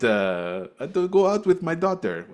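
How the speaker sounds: the father retelling the date idea, mock Romanian accent. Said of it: "I don't know what that accent is".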